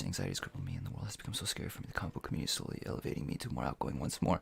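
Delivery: soft, fast whispered reading; whispering. Real, not acted. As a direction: whisper the comment quickly. Reading a viewer comment.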